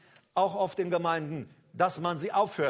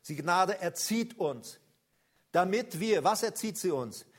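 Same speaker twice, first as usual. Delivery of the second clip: ordinary delivery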